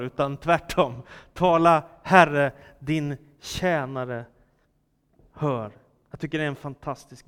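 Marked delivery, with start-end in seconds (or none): 1.3-5.7 solemn emphasis. Acted. Preacher gives the right answer after a misquote, solemn and slow. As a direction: proclaim it slowly, then sink to the last word.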